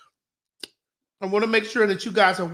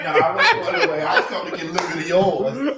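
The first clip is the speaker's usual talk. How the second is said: laughing; 5 semitones lower, faster than usual. Real, not acted. Laughing denial.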